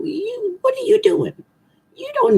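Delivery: high squeaky mock reproach; mock scolding. Astrologer teasing cheating Taurus readers, high incredulous.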